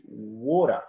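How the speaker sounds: American "water", drawn out slowly; stretched American "water" with final r; American pronunciation. Shown as a demonstration. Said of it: "the American people".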